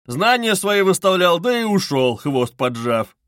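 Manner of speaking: heated, swaggering outburst; tipsy shouting. A narrator's reading. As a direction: rant boisterously like a drunken man. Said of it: "закричал хмельной Разумихин".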